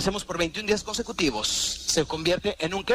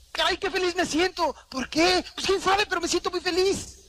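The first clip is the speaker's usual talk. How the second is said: high, bright-voiced burst of joy; giddy happiness; 12 semitones higher than usual. A person motivated by habit exclaiming, giddy and high. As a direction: sound overjoyed for no reason.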